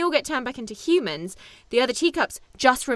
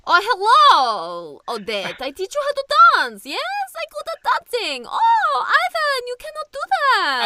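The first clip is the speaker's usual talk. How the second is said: high, loud mock European child accent, broken English; vague European accent; 12 semitones higher, 6 dB louder than usual. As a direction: ham up a silly vaguely European kid voice, much higher. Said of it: "I give them vaguely European sounding accents".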